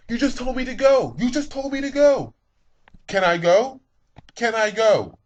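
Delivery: high, loud exasperated yelling; frustrated shout. Retold or imitated. Driver yelling at the other car at the stop sign, loud and exasperated. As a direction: yell it at the other driver, fed up.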